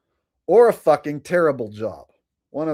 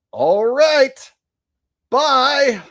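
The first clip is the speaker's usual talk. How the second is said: high, louder put-on farewell; much higher made-up cowboy goodbye; 12 semitones higher, 5 dB louder, much slower than usual. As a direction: sign off in the squeaky gunslinger voice. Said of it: "have a similar voice".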